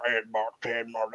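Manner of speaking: low, mumbled imitation of hillbilly accent; garbled hillbilly accent. Imitating farm people nobody can understand, mumbled hillbilly accent. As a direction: swallow the words in a mock backwoods accent. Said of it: "What the fuck did that guy just say?".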